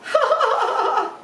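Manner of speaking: high cackling laugh; hearty laugh. Real, not acted. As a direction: burst out laughing at the joke. Reacting to the guest's joke.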